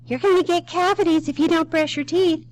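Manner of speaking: high, loud mock-nagging mother; scolding mom. Mother warns child before the dentist, high loud nagging. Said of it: "your mother said".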